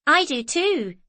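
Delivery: put-on a high, loud child's shout across the room. A narrator's reading. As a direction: call it out from the window seat, defiant. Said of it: "shouted Mary from the window seat".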